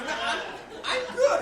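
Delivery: high, loud laughing backpedal; panicked refusal. Retold or imitated. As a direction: back away in alarm. Refusing higher dose.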